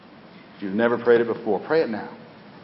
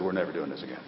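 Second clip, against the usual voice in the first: low, hushed confession; private aside; 6 semitones lower, 11 dB quieter, faster than usual. Retold or imitated. Husband to wife when no one else listens, hushed aside. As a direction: say it quietly, just for one listener. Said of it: "when no one else was listening".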